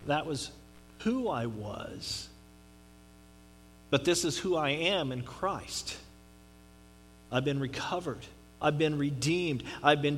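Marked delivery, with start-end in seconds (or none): none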